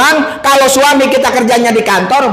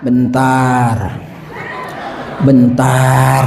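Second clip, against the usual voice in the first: much lower, drawn-out wife; slow lazy wife; 11 semitones lower, 3 dB quieter, much slower than usual. Acted. The wife stalls her husband's coffee request, low and slow. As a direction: answer lazily, low and slow.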